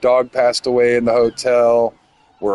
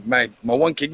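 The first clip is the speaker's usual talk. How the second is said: ordinary talk, nothing marked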